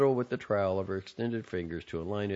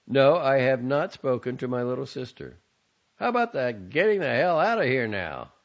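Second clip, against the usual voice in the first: put-on louder, exasperated young man; irritated young man; 8 semitones higher, 9 dB louder than usual. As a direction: snap at a nagging mother. Son snaps at mother.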